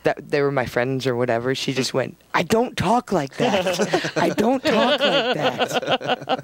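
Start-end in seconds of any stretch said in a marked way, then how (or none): 2.3-6.4 slow, high, drawn-out imitation of mom; a hammed-up mom protesting, much higher and slower; mother's voice. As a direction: stretch it out like her indignant mother. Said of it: "that voice actually came from".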